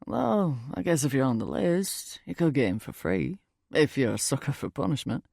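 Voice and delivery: put-on British-accented stranger, offhand; offhand remark in a British accent. A narrator's reading. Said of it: "He has this British accent".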